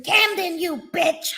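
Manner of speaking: high mock yell at Camden; imagined angry yell. Imagining her shouting at Camden, high mock yell. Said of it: "I can hear her saying".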